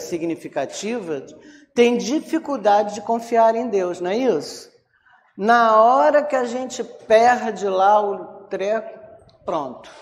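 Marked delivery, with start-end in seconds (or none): none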